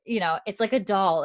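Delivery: ordinary talk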